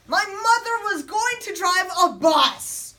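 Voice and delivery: loud, high and drawn-out announcement; dramatic exclamation. Acted. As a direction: proclaim it slowly, high and loud. Dramatic reveal in story.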